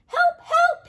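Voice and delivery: very high, very loud terrified shriek; hammed-up panicked yell; crying for help. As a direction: scream it out as he flees. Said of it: "he cried".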